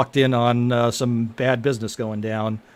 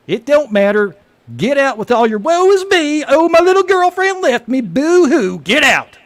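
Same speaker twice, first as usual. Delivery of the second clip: high, loud sneering yell; mock his heartbreak while shouting; a bartender jeering at a dumped customer, loud and high; mocking angry bartender; 13 semitones higher, 7 dB louder than usual. Bartender mocks the heartbroken guy.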